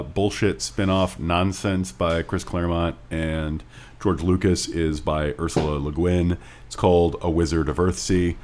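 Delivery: ordinary talk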